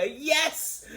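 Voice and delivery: high, drawn-out "yes" squeal; delighted cheer. Retold or imitated. Fan reliving seeing Rufus as a hologram, delighted high cheer. Re-enacting own delighted reaction.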